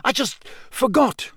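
high, flustered protest; impatient. A narrator's reading. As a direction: defend yourself with exasperation. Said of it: "he said impatiently".

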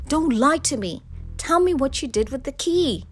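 husband rounding on his wife; angry demand. A narrator's reading. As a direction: press hard for a confession. Husband angrily interrogates wife.

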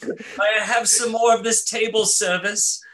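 high put-on Cockney plea; Cockney orphan. Friends riff on a Dickensian orphan, Cockney. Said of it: "a Dickensian orphan".

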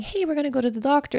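put-on bright, high reassuring voice to a child; sing-song mom reassuring a scared toddler; cheerful mom voice. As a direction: sell the doctor trip as fun. Mom soothing sick toddler.

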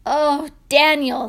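loud, heartfelt exclamation; king overcome with relief; amazed, relieved king. Acted. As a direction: cry it out in wonder.

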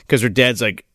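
ordinary talk, nothing marked

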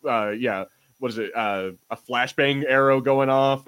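usual speaking voice

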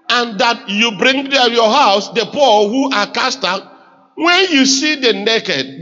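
emphatic, measured reading aloud; congregational scripture reading. Acted. Pastor leading the congregation through a verse, emphatic reading. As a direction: read it out firmly for everyone to follow.

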